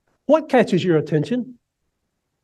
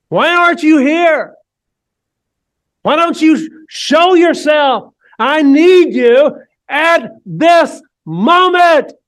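desperate plea; 9 semitones higher, 6 dB louder, slower than usual. Acted. Voicing a desperate prayer.

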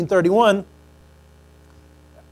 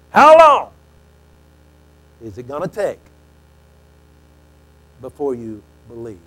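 how long, then fade away; exasperated Jesus; 6 semitones lower, 6 dB louder than usual. Acted. Jesus rebuking the faithless generation, loud then hushed.

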